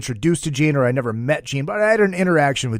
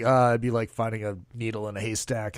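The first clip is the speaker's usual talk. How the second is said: low, quieter deadpan star voice; deep Gene voice; 6 semitones lower, 9 dB quieter than usual. Retold or imitated. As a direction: drop low and unimpressed. Gene's reply in story.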